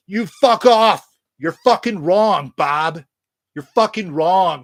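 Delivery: hammed-up rage at a critic; mock anger. Vlogger mock scolding anyone who dislikes the band, hammed-up anger.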